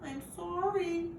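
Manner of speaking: high, slow, pleading whimper; a puppy begging forgiveness, high and slow. A narrator's reading. Puppy apologising to angry owner, high slow whimper. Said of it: "I whimper".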